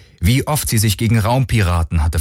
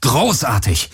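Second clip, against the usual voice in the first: sarcastic outburst with a pitch swoop; exasperated call; 3 semitones higher, slower than usual. A narrator's reading. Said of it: "rief er". (entnervt ausgerufen)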